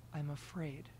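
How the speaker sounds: whispering. A narrator's reading. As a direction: breathe it out like from a cave. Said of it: "He was whispering now".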